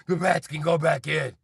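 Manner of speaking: ordinary talk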